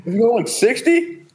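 high-pitched incredulous question; real disbelief. Real, not acted. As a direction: ask in amazed disbelief, voice way up. Incredulous question about speed.

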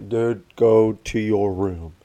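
slow, spaced-out parental order; drawn-out retold telling-off; exasperated slow order. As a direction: spell it out slowly for a kid not listening. Father repeats the order slowly.

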